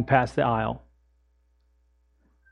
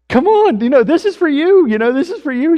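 much higher and louder, coaxing; hammed-up desperate coaxing; frantic high pleading; 13 semitones higher, 8 dB louder than usual. Pleading with woman through car window.

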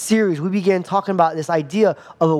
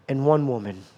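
whispered, then softer and lower; hushed; 6 semitones lower, 5 dB quieter than usual. Acted. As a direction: drop to a hush to drive it home. Preacher drops to hushed emphasis.

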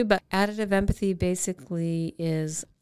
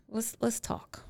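whispered calm; 4 semitones higher, 6 dB quieter, slower than usual. Shown as a demonstration. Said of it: "then say".

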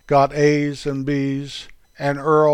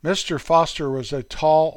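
ordinary delivery

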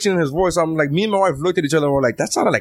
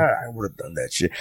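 envious mutter; 8 semitones lower, 7 dB quieter than usual. Retold or imitated. Muttered envy at TV.

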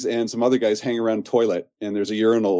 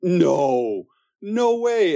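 higher, stunned "no way"; retold happy disbelief; 8 semitones higher, much slower than usual. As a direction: relive the thrilled disbelief. Retold delighted disbelief at casting.

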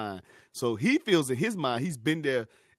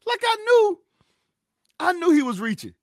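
emphatic outburst; 11 dB louder than usual. Real, not acted. Emphatic outburst about Rapaport.

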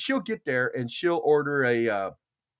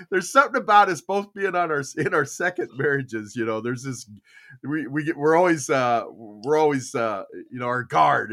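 normal talking voice

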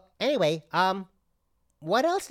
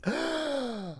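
offended gasp; 10 semitones higher, 5 dB quieter than usual. Acted. Offended waiter gasps.

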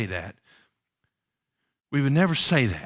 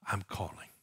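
soft whisper, much quieter; hushed whisper of the call; 7 semitones lower, 12 dB quieter than usual. Acted. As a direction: whisper it tenderly.

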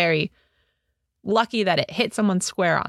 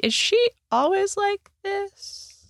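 higher, drawn-out question; a pointed, slow query pitched up; incredulous aside; 11 semitones higher, slower than usual. Acted. Doris asks Liam about Patrice, incredulous and drawn out.

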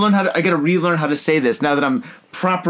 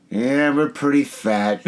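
laughing; slower than usual. Retold or imitated. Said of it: "I was like".

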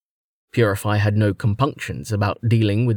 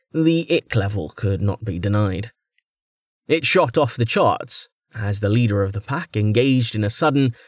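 ordinary delivery

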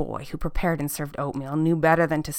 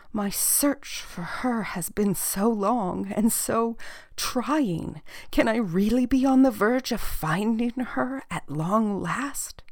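higher-pitched, drawn-out plea; long-suffering old woman, hopeful; 6 semitones higher, slower than usual. Acted. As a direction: voice a weary seeker daring to hope.

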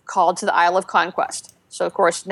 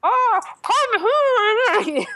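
very high, very slow, echoing tiny voice; tiny Death Knight gnome; 13 semitones higher, much slower than usual. Retold or imitated. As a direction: drag it out high like a cute but ominous little gnome. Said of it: "It's like that echoey sound, but with a little tiny voice".

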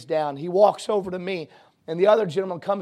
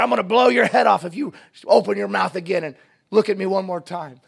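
threatening robber; 3 semitones higher, 7 dB louder than usual. Retold or imitated.

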